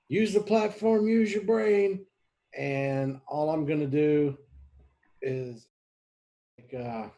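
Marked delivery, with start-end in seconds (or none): 0.1-2.1 high, slow, raised call to an ally; shout. Acted. Player shouting to ally Rayal mid-battle, high slow shout. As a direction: call out a command, stretched and high. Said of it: "I'm gonna shout at Rayal".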